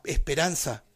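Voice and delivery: usual speaking voice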